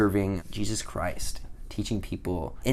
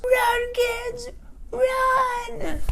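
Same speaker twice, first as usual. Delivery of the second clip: put-on much higher, falsetto old lady voice; old lady's cry; much slower than usual. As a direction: shout it in a shrill old woman's falsetto. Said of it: "she goes".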